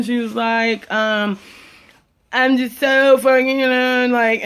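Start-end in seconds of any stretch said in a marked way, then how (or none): 2.3-4.4 mock hysterical crying. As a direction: imitate her wailing, words dragged out. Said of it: "starts crying hysterically".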